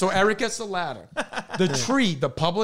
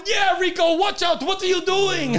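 high mock shouting at someone up high; hammed-up high, scolding shout; imitated alarmed father; 12 semitones higher than usual. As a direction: yell a warning like a panicked dad. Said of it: "Senior starts going".

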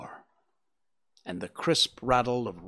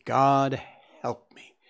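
breaking into sobs; slower than usual. Acted. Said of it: "He burst into convulsive sobbing".

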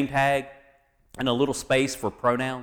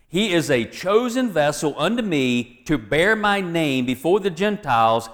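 higher-pitched, emphatic Bible reading; preacher declaiming scripture with lifted pitch; 4 semitones higher than usual. Acted. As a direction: proclaim the verse, punching the list. Reads scripture aloud to congregation.

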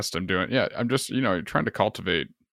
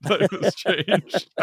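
drawn-out, high mock-solemn echo of the words; mock dramatic echo; 10 semitones higher, much slower than usual. Teasing a friend about his new dad life, slow high mock gravity. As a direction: tease your friend by repeating his line slowly and grandly.

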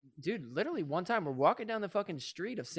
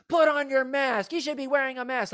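loud, high mock crowd yell; crowd scolding; 8 semitones higher, 4 dB louder than usual. Six bystanders scolding a couple in the street, mock shout. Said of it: "everybody at the same time".

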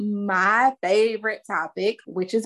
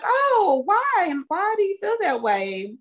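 curious mom; 12 semitones higher than usual. Retold or imitated. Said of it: "I was like".

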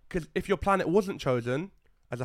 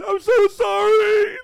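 high, drawn-out loud wail; hammed-up sobbing shout; mock weeping apology; 11 dB louder, much slower than usual. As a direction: bawl the apology, stretched out and over the top. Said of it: "He's, like, weeping".

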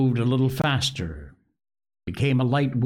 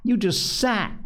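contemptuous; 10 semitones higher than usual. A narrator's reading. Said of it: "said Jack contemptuously".

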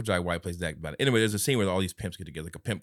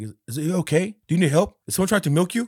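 worried dad voice; 8 semitones higher, 5 dB louder than usual. Retold or imitated. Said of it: "He's like".

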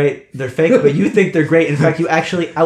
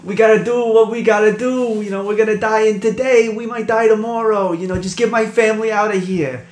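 higher, grim resigned guard; 8 semitones higher, 3 dB louder than usual. Acted. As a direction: steel yourself to die. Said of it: "Tommy's like".

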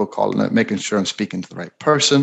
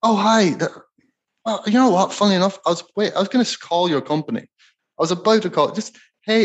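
much higher, flustered voice of the person being called; a caught-off-guard customer stumbling over words; surprised prospect; 10 semitones higher than usual. Retold or imitated. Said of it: "See what happens".

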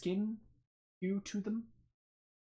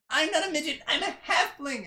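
high-pitched, protesting little voice; indignant halfling; 13 semitones higher, 4 dB louder than usual. Acted. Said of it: "And she jumps up".

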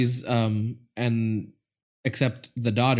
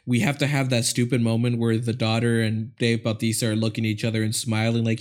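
ordinary talk

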